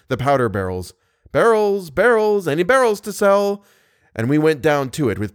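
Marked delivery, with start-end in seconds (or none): 1.3-3.6 high, loud street-seller call; vendor's cry. A narrator's reading. Thirsty captives echo barrel seller, high calling cry. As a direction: call it out like a hawker.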